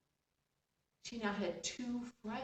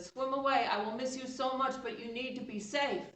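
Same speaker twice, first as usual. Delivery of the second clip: higher, louder urgent call; 7 semitones higher, 6 dB louder than usual. Acted. Nomi calls out to warn her fish friend.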